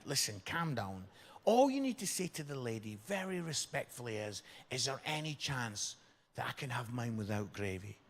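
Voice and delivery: low, soft and soothing; quietly reassuring friend; calm advice. Retold or imitated. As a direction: settle him down gently. Calm friend advising the student.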